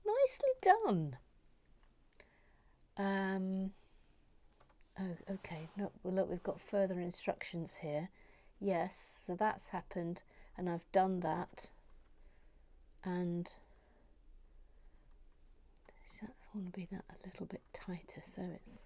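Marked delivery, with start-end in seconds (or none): 0.1-1.2 much higher, sing-song nicely done; high pleased repeat; high praise. Real, not acted. 15.9-18.8 fast whisper to herself; quick unvoiced whisper. Real, not acted.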